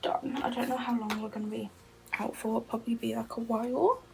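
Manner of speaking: soft unvoiced whisper throughout. Real, not acted. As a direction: keep it hushed, barely voiced. Quiet whispered chat at home.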